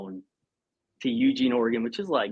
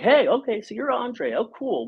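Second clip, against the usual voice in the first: put-on higher, bubbly voice for the girls at the desk; young receptionists; 11 semitones higher than usual. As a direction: lift the voice to play the friendly young girls. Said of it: "They're like".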